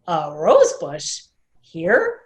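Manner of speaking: can't believe what he hears; doubtful surprise. Acted. Mister Claudel questioning Wanda's bush: slow, loud disbelief.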